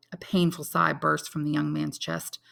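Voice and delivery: usual speaking voice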